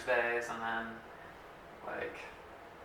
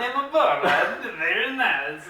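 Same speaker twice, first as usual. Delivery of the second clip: Borat; 8 semitones higher, 7 dB louder than usual. Acted. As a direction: do a hammed-up catchphrase impression, laughing. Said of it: "it's very close to Borat".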